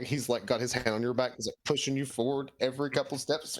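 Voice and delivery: normal talking voice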